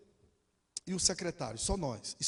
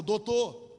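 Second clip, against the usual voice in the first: louder call after someone leaving; raised-voice appeal; 3 semitones higher, 6 dB louder than usual. Retold or imitated. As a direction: call out for his attention. Calls lawyer walking away.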